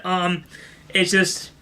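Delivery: ordinary delivery, nothing marked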